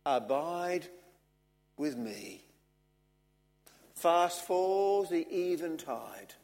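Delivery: slow, measured reading with long pauses; solemn hymn recitation. Acted. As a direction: let each line sink gently away.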